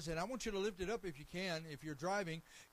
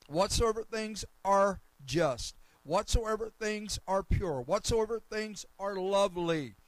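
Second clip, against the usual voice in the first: loud, slow, emphatic scripture proclamation; ringing sermon delivery, phrase by phrase; preach; 11 dB louder than usual. Real, not acted. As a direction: proclaim each phrase at full force.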